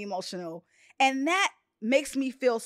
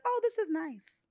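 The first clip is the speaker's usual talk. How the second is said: mild polite quote; 6 semitones higher, 6 dB quieter than usual. Retold or imitated. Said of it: "it's not just".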